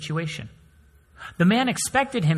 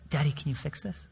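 put-on much softer, small child voice; child asking; 8 dB quieter than usual. Daughter asking her dad to fix her doll, soft child voice. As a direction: ask softly like a little girl.

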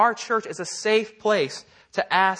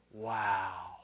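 hushed awe; 11 semitones lower, 13 dB quieter than usual. Retold or imitated. Abram awed by the stars.

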